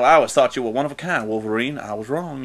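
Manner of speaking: a hint of a deep South accent; Southern accent. Imitating Brian Cox's Stryker with a light Southern accent. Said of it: "has this really deep South accent".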